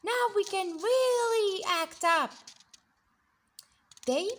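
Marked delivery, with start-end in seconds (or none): 0.0-2.3 put-on high, loud mischievous kids' voice; gleeful kids. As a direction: plot trouble like giddy children. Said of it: "Whoa yelled the kids".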